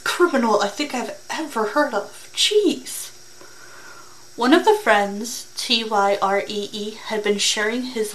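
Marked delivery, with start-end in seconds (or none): 2.3-3.1 high, squeezed jeez of disbelief; exasperated jeez. Real, not acted. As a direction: throw up your hands with a high jeez. Reactor reacts to stupid criminal.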